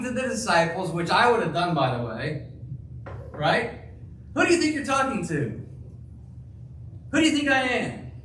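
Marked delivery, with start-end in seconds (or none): 4.3-8.1 high, quick indignant rebuke; indignant scolding. Acted. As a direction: act out an offended, higher-pitched telling-off. Preacher acts out indignant scolding of disciples.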